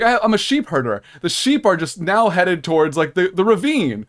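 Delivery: loud, high, incredulous parody; mock shepherd. Shepherd watching sheep head for a ravine, loud mocking parody. Absurd shepherd analogy voiced.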